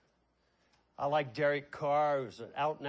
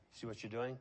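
usual speaking voice

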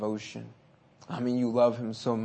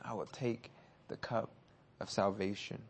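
softer, wondering repeat to himself; puzzled musing; 7 dB quieter than usual. Retold or imitated. Pastor recalling his confusion, softly musing. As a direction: mull it over quietly, puzzled. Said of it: "I was looking at this like".

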